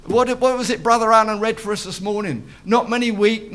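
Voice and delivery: ordinary delivery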